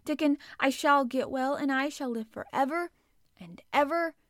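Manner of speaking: loud, high, joyful proclamation; exultant cry. A narrator's reading. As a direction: cry it out to the whole garden. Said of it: "he cried out".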